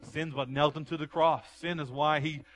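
loud, high, rising preacher's cry; fiery pulpit preaching; preaching at full force. Acted. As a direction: build the sermon to a shout.

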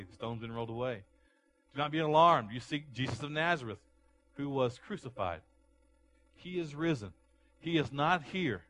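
loud, ringing angel's announcement; booming angel voice, lightly acted; angel's proclamation. Preacher voices the angel at tomb.

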